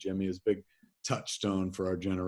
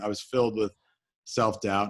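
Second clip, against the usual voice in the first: ordinary talk, nothing marked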